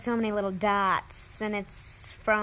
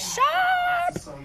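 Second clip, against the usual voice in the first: high, drawn-out squeal on the last word; gushing approval. Real, not acted. Viewer admiring his suit, in a high gushing squeal. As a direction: slowly savor it, then shoot up high.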